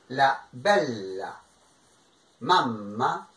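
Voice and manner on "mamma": normal talking voice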